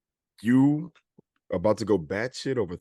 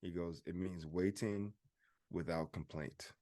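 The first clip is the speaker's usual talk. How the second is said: much softer, slower teaching exchange; a father softly quizzing his son; soft-spoken; 3 semitones lower, 12 dB quieter, slower than usual. Retold or imitated. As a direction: gently quiz a child, quietly. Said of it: "I say, I ask him".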